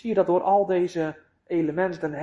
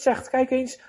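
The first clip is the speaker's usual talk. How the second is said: ordinary talk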